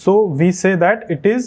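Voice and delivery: very slow, careful English reading; slow reading aloud. Shown as a demonstration. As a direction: read it out word by word. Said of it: "A tree is a fixed place". (धीरे धीरे अंग्रेज़ी पढ़ना)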